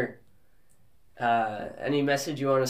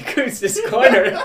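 laughing; 9 semitones higher, 8 dB louder, slower than usual. Real, not acted. Host laughs after joke ending.

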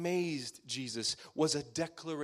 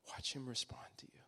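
whisper; 4 semitones lower, 9 dB quieter than usual. Acted. Intimate close of the point.

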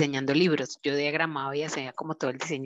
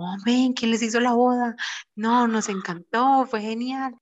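excited guests gushing; 7 semitones higher, 4 dB louder than usual. Retold or imitated. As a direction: gush like a thrilled wedding guest. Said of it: "muchos nos dijeron como". (invitados emocionados elogiando la boda, voz alta y efusiva)

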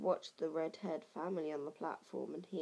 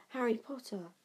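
chorused in awe; 5 semitones higher than usual. A narrator's reading. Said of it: "chorused the twins".

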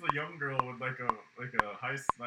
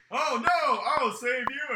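hero voice; 11 semitones higher, 6 dB louder, much slower than usual. Retold or imitated. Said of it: "he's like".

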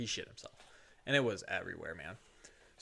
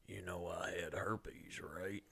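hushed, slow whisper of a confession; 4 semitones lower, 9 dB quieter, much slower than usual. Retold or imitated. Old patient whispering to his nurse, slow hushed confession. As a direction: breathe it out slow and secret. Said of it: "and he goes".

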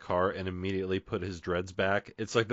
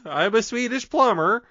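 high, slow silly impression; goofy high voice; 14 semitones higher, 8 dB louder than usual. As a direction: play a silly Swedish plumber.

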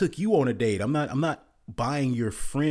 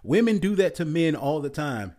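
usual speaking voice

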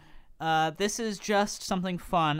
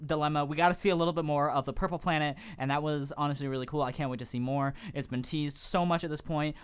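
speed review; 4 semitones higher, 5 dB louder than usual. Pretend. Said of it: "You have to speak as fast as you can".

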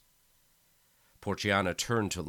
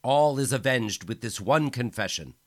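loud, high triumphant proclamation; bellowing; 6 semitones higher, 10 dB louder than usual. Acted. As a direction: proclaim it over the crowd. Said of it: "Micheletti bellowed".

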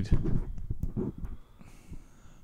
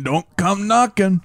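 high, slow chanted punchline; playful call-out; 9 semitones higher than usual. Pretend. Friend joking about rocking speakers, high playful call-out.

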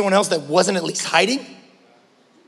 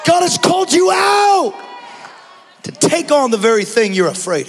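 whooped sermon; 6 semitones higher than usual. Acted. Pastor urging the church to take courage, sung and shouted. Sung preaching over music.

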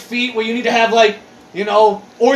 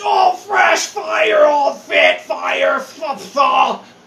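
slow, shouted hype-commenter parody; mocking commenters; 5 semitones higher, much slower than usual. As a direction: ham up the dumb comments. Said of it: "everyone in the comments".

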